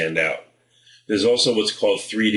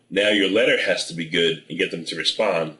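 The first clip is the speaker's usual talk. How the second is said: normal talking voice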